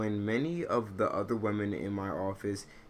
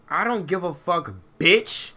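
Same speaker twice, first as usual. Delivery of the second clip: loud, high angry yelling; 9 semitones higher, 7 dB louder than usual. Real, not acted. A daughter yells again that it's her mother's name. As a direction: bark it out in real anger.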